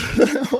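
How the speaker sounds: a sudden high giggle at himself; a quick high laugh; high burst of laughter. Real, not acted. Laughs at his own reasoning.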